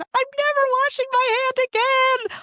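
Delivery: high, loud fangirl shriek; starstruck fan squeal. Retold or imitated. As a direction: squeal it like a starstruck teenager at a concert. Starstruck fans squeal after touching him.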